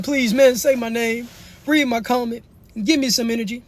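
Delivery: high-pitched imitation of a pleading fan; mock begging. Streamer mimics viewers begging for attention, mock begging. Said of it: "On the knees, begging".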